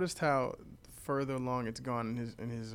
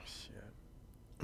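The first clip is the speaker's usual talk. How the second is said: whisper; 3 semitones lower, 15 dB quieter than usual. Real, not acted. Unvoiced low exchange.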